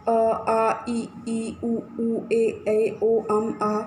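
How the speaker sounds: each vowel sounded out separately, drill style; vowels said one by one to children; vowel sounds demo. Shown as a demonstration. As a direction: repeat the vowel chart aloud for the class. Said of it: "जहाँ कहीं भी ये sound हमें सुनाई देगा".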